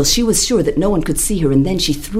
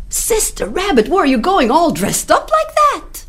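higher, worried animal voices; alarmed animals; 12 semitones higher than usual. Acted. Animals worried ask the dressed-up rabbit.

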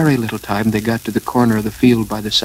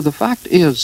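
hesitant explaining; 7 semitones higher than usual. A narrator's reading. Pooh struggles to explain himself.

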